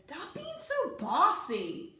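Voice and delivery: high, slow, emphatic telling-off; scolding vowel character. Acted. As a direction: act out a letter scolding its bossy neighbour. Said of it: "and he says".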